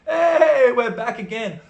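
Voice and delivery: loud, high drawn-out hey; retold party cheer. Girls pop out as music returns, rowdy cheer. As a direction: whoop it up as the party restarts.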